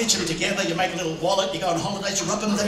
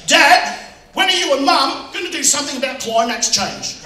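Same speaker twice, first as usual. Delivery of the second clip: raised, loud voice of a son challenging Dad; son's indignant question; 8 semitones higher, 8 dB louder than usual. Retold or imitated. Son confronting his dad about climate change, indignant. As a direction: call out an earnest demand.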